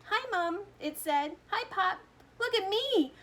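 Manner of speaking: high, loud squeak like a mouse; tiny squeaky voice. A narrator's reading. Shrunken boy greeting his parents, high mouse squeak. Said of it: "tiny little voice ... squeaking of a mouse".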